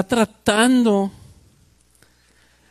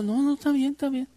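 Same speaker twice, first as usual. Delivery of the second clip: softer, high, timid retreat; meek backing down; 8 semitones higher, 5 dB quieter, faster than usual. Retold or imitated. Cowed speaker backs off from the tough guy, meekly. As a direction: shrink back and give in quickly. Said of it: "backing down after the confrontation".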